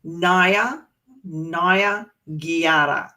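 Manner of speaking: Naya Giara said slowly, syllable by syllable; teaching Darug pronunciation to the class; slow demo of Naya Giara. Shown as a demonstration. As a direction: model the Darug words slowly. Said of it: "we use the term na".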